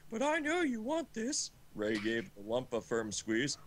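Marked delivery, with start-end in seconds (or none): 0.1-1.5 high, hammed-up seducer voice; mock sultry Ray. Ray teasing the trapped fox, high hammed-up seducer. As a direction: camp it up as the cocky wolf.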